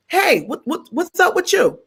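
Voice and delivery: lightly camp high voice for the stranger; curious guy calling out, voiced high; high-voiced club guy.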